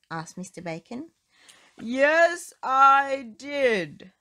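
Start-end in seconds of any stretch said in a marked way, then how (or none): 1.8-4.1 very loud, higher shout; bellowing yes; top-of-voice shout. Acted. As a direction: yell it with everything. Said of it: "shouted at the very, very top of his voice".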